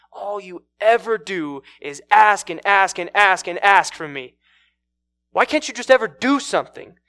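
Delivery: loud, exasperated rant with hammered repeats; acted angry berating. Man berates the woman.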